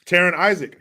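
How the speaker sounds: ordinary delivery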